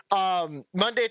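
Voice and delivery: normal talking voice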